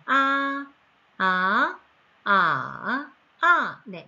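아 sung through first, second, third and fourth tone; 아 in level, rising, dipping and falling tone; four Mandarin tones on a. Shown as a demonstration. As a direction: demo each Chinese tone on 아. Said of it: "이런 성조라는 게 있기 때문에".

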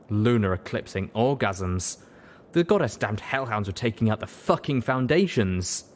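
ordinary delivery, nothing marked

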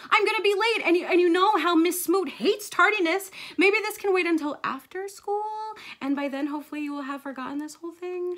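put-on urgent childlike excuse-making; pleading kid. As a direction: talk mom out of it sweetly. Gabby pleads to postpone lecture.

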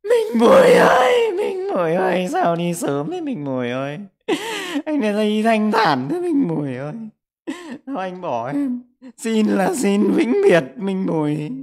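hammed-up wail, loud, high and drawn out; hopeless mock screaming. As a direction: scream the name as if in vain, overdoing the despair. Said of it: "cào thét tên con trong vô vọng đi". (giả vờ gào thét trong vô vọng)

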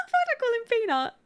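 high, fast words through laughter; laughing. Real, not acted. Player laughing at misnaming her Sim, laughing. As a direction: giggle at your own slip. Laughs at own naming mistake.

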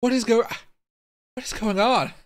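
high-pitched, baffled outburst; real bafflement. Real, not acted. Streamer asking viewers what is going on, baffled.

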